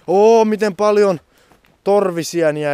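real delight at finding chanterelles; excited. Real, not acted. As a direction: burst out at all the mushrooms. Delight at mushrooms.

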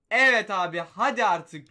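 loud, urgent plea at the screen; loud gamer begging; loud pleading. Real, not acted. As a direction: beg the game out loud. Pleading loudly for a good card.

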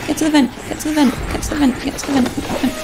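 fast panicked high chant; yelling while running away. Real, not acted. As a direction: urgently repeat it while fleeing. Fleeing, urgent repeats.

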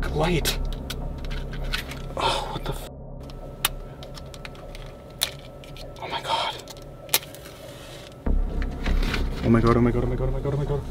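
terrified hushed whisper; nervous night vlog whisper. Real, not acted. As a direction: whisper in real fear. Alone in the dark, scared.

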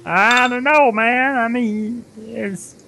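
high, goofy yokel voice; high falsetto simpleton. Shown as a demonstration. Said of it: "yokel simpleton voice".

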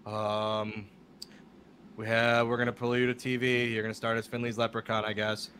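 low, deadpan drone; monotone Tommy Dreamer. Retold or imitated. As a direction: deliver the job offer without any inflection. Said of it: "talks in such a monotone voice".

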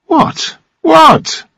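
baffled, high-pitched outburst; startled exclamation. A narrator's reading. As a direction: exclaim it twice in surprise.

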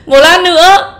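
laughing through a hiccup; hiccuping, half laughing. Real, not acted. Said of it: "Đã bảo bị nấc thì không ai tin à".

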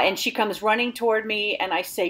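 ordinary talk

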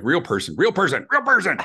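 building to a loud, high-pitched demand; impatient mock yell. Caller demanding a human from a recording, impatient mock yell. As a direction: shout it repeatedly as if at an automated phone menu. Retold yelling at phone menu.

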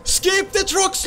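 rising, increasingly frantic gamer chant; escalating urging. Real, not acted. Excited repeated command while playing.

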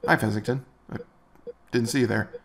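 ordinary delivery

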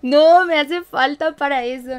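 cracking up at herself; laughing admission. Real, not acted. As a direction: laugh it out, admitting defeat.

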